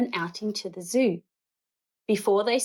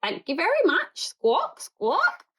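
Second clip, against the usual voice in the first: higher squawky parrot talk; 8 semitones higher than usual. A narrator's reading. Parrot thanking Paddington after stealing sandwich, squawky. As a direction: chirp it like a cheeky parrot.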